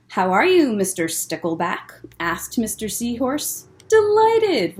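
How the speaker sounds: gentle picture-book storytelling voice; reading to children. A narrator's reading. Librarian reads Mister Seahorse aloud to children, gentle storytelling.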